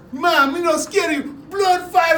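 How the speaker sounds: loud, high imitated Jamaican threat; mock Jamaican bluster. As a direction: shout a challenge in a put-on Jamaican accent. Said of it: "and he went from".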